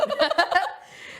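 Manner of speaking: loud laugh; burst of laughter. Real, not acted. Joke about the boat.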